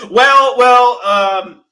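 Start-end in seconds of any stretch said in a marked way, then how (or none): none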